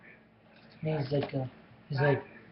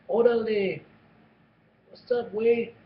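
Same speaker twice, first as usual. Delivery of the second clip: a light put-on Mexican accent, pitched higher; 6 semitones higher, slower than usual. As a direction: greet Wade like a Mexican Wolverine, in a Mexican accent. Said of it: "something about a Mexican Wolverine".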